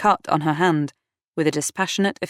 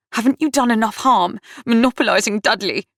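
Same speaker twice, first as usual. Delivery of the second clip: higher, hostile accusation; indignant demand; 6 semitones higher than usual. A narrator's reading. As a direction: round on a rival, protecting your brother. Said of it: "she demanded".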